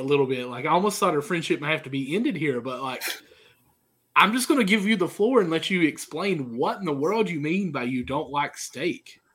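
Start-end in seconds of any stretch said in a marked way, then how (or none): none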